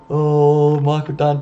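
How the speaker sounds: ordinary delivery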